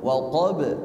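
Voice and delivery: "waqab" with short vowel and immediate bounce on b; direct qalqalah. Shown as a demonstration. Teacher shows correct way, "waqab" without swaying. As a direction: go straight to the b in "waqab". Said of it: "harus langsung".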